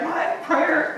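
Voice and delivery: high, disbelieving squeak; mock disbelief at prayer; incredulous. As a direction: act shocked that prayer is the plan. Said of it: "And you're like".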